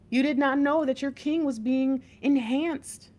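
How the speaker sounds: slow and lifted, stressing each repeat; emphatic reading. Acted. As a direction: build it like a revelation. Emphatic repeated revelation, slow and high.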